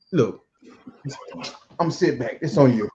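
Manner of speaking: ordinary talk, nothing marked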